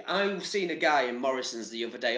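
ordinary talk

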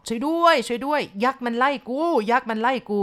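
high-pitched narrated yell of terror; panicked cry for help. A narrator's reading. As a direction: cry out like a man chased by a giant. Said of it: "ชาวบ้านได้ยินในยุ้ยตะโกนลั่นบอก".

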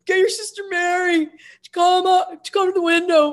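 put-on high, loud drunken-style holler upward; imitated shout from the street; mock calling up from below. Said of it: "he's like".

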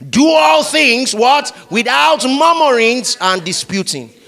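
preacher calling out the verse; call-and-response reading. Shown as a demonstration. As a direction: lead the crowd line by line. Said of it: "Want to go, everybody?".

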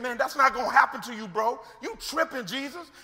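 imitating a worked-up Peter telling someone off; heated. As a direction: act like a vein is popping as you protest. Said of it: "got a vein sticking out of his head".

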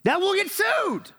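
high, slow mock outcry; excited yell. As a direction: wail it out way up high. Playful outburst about lawsuits.

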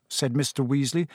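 ordinary delivery, nothing marked

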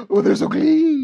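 very high, squealing falsetto; hammed-up royal delight in a piping voice; Queen of England. Co-host joins as delighted Queen.

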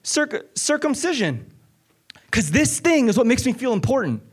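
high, driving sermon voice; pleading with the congregation, urgent preaching; impassioned preaching. Acted. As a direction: preach it with rising fire. Preaching builds to full force.

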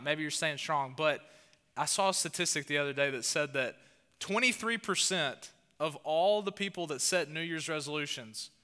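ordinary talk

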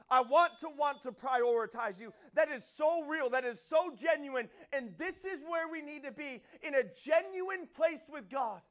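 loud, high, driving sermon delivery; fervent pulpit preaching, building then easing off; full-force preaching. Acted. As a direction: preach it hard to the room.

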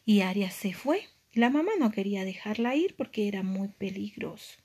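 ordinary delivery, nothing marked

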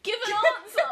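high, very loud comic shout; mock demanding yell. Joking demand to someone hesitating over a date, mock yell.

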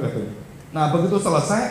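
ordinary talk

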